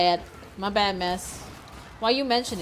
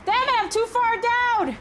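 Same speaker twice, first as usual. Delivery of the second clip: much higher, strained panic, slowing on don't close; panicked pleading; 12 semitones higher, slower than usual. Real, not acted. Streamer lost as the timer runs out, high panicked plea. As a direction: beg the door not to shut.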